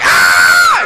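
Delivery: low, loud startled yelp; girl screaming at the monster roar. Retold or imitated. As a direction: let out a loud fright cry. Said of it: "She's like".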